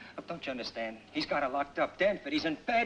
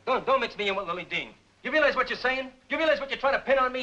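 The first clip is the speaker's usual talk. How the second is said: angry; 6 semitones higher, 4 dB louder than usual. Acted. Suspect accused of murder.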